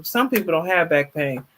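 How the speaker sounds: ordinary talk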